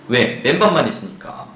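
usual speaking voice

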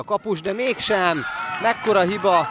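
rising shout at a near miss; excited sports commentary. Real, not acted. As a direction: burst out at the keeper's mistake. Commentator reacts to keeper error.

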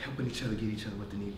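usual speaking voice

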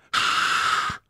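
sharp unvoiced shushing hiss; hushing hiss. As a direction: hiss everyone quiet. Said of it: "зашипел Воравка".

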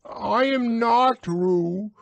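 high, loud, slow squeaky protest; loud Piglet voice. A narrator's reading. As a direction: insist loudly in a tiny voice. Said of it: "said Piglet loudly".

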